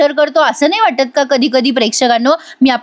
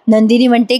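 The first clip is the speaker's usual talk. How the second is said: normal talking voice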